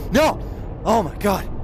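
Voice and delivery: high, loud outburst; panicked exclamation. Real, not acted. Player slipping on a climb, panicked exclamation. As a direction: cry out as the climb goes wrong.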